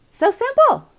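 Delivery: high, loud gushing So simple; excited squeal. Real, not acted. Host gushes to her viewers, excited. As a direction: squeal it with delight.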